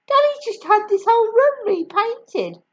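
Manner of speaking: put-on boy protesting about the room; fretting. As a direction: complain in dismay. George fretting.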